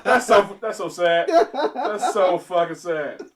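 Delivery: loud, incredulous cracking up; real laughing disbelief. Real, not acted. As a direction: shout it through helpless laughter. Cracking up at the named choice.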